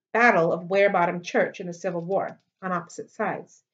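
ordinary delivery

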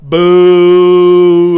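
long, loud jeering boo. Real, not acted. Booing his partner's bad rap, loud. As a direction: drag out a booing jeer.

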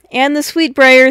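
loud eager reminder. Acted. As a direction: eagerly chime in. Phyllis eager.

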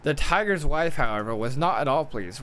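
ordinary delivery

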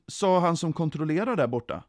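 plain reading voice

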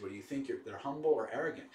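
ordinary delivery

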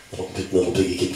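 usual speaking voice

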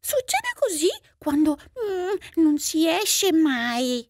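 huffy cat voice broken by meows; resentful cat. Acted. Cat tells off stay-at-home mouse, resentful. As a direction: scold with meows between phrases, dragging out the end. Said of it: "disse il gatto risentito".

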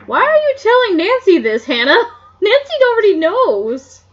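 loud, high laughing aside; laughing aloud. Real, not acted. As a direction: break into laughter mid-question. Reader laughing aside to character.

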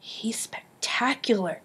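slow, breathless awed whisper; hushed awed whisper. A narrator's reading. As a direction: whisper it in wonder. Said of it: "she whispered".

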